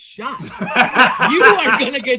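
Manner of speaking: high and slow, laughing through it; half-laughing, half-terrified plea; laughing panic. Retold or imitated. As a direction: friend scared they'll be shot, laughing high. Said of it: "He's like".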